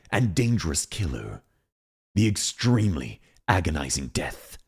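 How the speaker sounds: slow, low trailer announcer; film trailer voice. Pretend. Streamer doing a movie trailer on request, deep and slow. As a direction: intone it deep, with long dramatic pauses. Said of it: "Film trailer, please".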